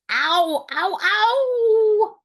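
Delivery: high, loud, crying ows; wolf's howl. Acted. The wolf with a slammed nose, loud high howls of pain. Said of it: "howled the wolf".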